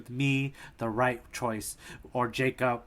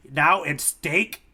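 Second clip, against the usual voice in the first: slow puzzled Buggy; much slower than usual. Retold or imitated. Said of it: "and then Buggy goes".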